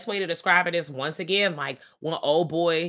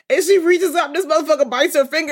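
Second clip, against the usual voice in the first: ordinary delivery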